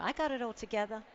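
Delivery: put-on quick, breezy American accent. Speaker mocking looking unexhausted, in an American accent. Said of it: "Not sure why I did an American accent there".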